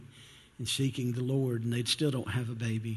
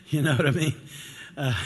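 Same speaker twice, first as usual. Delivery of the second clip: said through a laugh, louder; 3 dB louder, faster than usual. Real, not acted. Pastor laughing as he asks the congregation. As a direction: laugh the question out.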